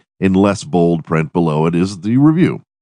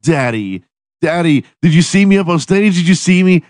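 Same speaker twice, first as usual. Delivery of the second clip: put-on high, bright little girl's voice, much higher and louder; excited little girl; 11 semitones higher, 4 dB louder than usual. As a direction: play a six-year-old begging for praise. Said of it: "She comes running up to you".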